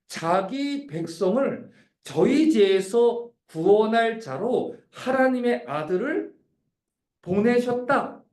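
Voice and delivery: slow, deliberate, weighty words; solemn, drawn-out declaration; slow solemn proclamation. Acted. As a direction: spell out the gospel slowly. Quotes New Testament message slowly.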